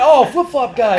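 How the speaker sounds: high, loud fan spotting him, laughing; mock a stranger yelling out his nickname; excited fan. Fans on the street spot him, loud laughing shout. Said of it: "They're like".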